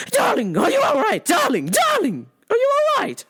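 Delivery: panicked mother calling out on the phone; frantic mom. Retold or imitated. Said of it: "immediately calls me frantic".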